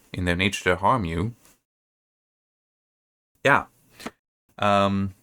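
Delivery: ordinary delivery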